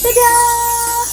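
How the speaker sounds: normal talking voice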